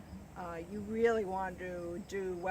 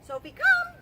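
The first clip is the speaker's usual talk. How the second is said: calling the dog; 12 dB louder than usual. Real, not acted. Dog training recall call.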